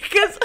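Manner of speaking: squealing laughter; high squeal laugh. Real, not acted. Laughing fit.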